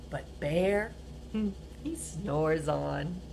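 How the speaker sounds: slow, soft sleepy refrain; hushed refrain. A narrator's reading. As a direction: let it drift off softly.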